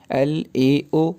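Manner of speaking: एल ए ओ spelled letter by letter, slow and separated; spelling out slowly. Shown as a demonstration. Teacher spelling syllables apart for the class, slow. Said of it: "अलहदा-अलहदा से pronounce करें".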